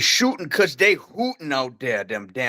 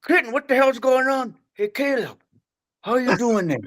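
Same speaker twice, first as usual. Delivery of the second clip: much higher yell, then gentler for Caleb; grandpa switching tone; 10 semitones higher than usual. Retold or imitated. Grandpa yelling at Clinton but nice to Caleb. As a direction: bark at Clinton, sweeten for Caleb. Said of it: "he would be like".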